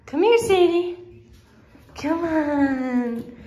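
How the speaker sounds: high, loud call to the puppy; calling the dog. Real, not acted. Owner calling the puppy across the house. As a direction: call her over, bright and loud.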